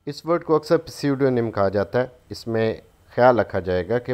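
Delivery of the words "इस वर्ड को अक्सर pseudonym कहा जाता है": ordinary delivery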